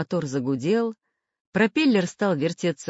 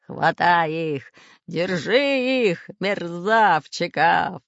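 slow, loud pirate growl; red-bearded pirate roar, slow and heavy; 5 dB louder, much slower than usual. A narrator's reading. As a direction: growl out orders, dragging the words. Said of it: "рычал рыжебородый пират Бульбуль".

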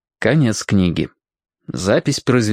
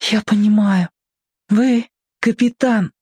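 put-on hushed boy's voice; 10 semitones higher than usual. A narrator's reading. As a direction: voice a boy speaking carefully, pitched well up. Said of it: "тихонько сказал мальчик". (тихонько, мальчишеским голосом)

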